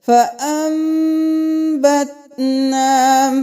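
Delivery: وَأَنبَتْنَا with the ن said as a long nasal m; correct recitation with meem. Shown as a demonstration. Teacher recites with meem instead of noon. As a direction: chant وَأَنبَتْنَا slowly as wa-am-batna. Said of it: "میم کی آواز آئے گی".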